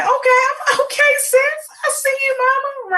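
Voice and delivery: put-on high playful hype-girl voice; hyped-up sis voice. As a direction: cheer her on like a girlfriend.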